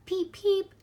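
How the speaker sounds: high, loud chirping peep; baby chick peeps. A narrator's reading. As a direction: squeak it like a tiny chick inside the egg. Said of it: "it said".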